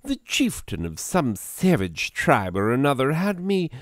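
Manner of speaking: theatrical posh British storyteller; upper-class gentleman's accent. Acted. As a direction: narrate it as a pompous English gentleman accent. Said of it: "upper-class gentleman's accent".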